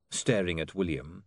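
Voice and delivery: ordinary delivery, nothing marked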